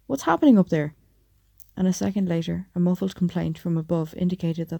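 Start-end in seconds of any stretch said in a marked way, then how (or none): 0.1-0.9 loud, high yell from below. A narrator's reading. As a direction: yell up the stairs. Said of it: "inhabited the offices below yelled".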